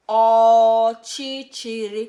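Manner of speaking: "Ọchịchịrị" drawn out slowly and loudly, every syllable clear; teacher drilling "Ọchịchịrị" slowly and loudly for repetition; slow model word. Shown as a demonstration.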